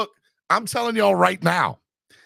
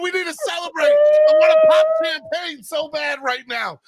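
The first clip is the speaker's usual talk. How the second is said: high, shouted celebration, nearly crying with joy; overjoyed yelling; 9 semitones higher, slower than usual. Real, not acted. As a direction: scream it like your team just won. Fan celebrating player trade.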